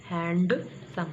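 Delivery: हैंडसम slowly split, HAN-DUH, then SUM; slow हैंडसम with a heavy D; common mispronunciation. Shown as a demonstration. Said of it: "क्या बोलते हैं".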